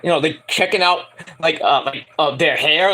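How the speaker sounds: ordinary delivery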